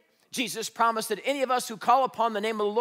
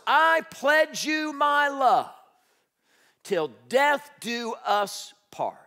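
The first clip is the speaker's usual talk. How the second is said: tender vow; 7 semitones higher, 4 dB louder, much slower than usual. Acted. Reciting wedding vow.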